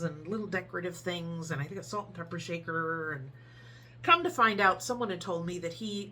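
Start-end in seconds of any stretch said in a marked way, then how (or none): none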